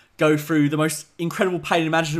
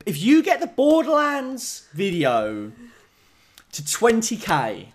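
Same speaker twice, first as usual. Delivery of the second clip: whiny begging, higher and slow; mock pleading; 6 semitones higher, slower than usual. Real, not acted. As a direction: beg pathetically. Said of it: "how pathetic you're making me".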